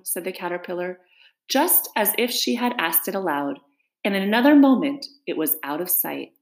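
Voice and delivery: ordinary delivery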